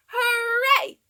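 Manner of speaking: high, loud cheer of hooray; shouted hooray. Acted. Teacher cheering hooray with the class. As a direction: cheer it out to the kids. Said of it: "shout hooray".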